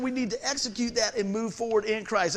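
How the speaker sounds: normal talking voice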